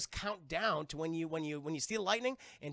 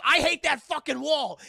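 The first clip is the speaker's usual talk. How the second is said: loud, high comic outburst; sudden exaggerated bellow; furious mock shout; 7 semitones higher, 13 dB louder than usual. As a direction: yell it in hammed-up rage. Specter lashing out, acted.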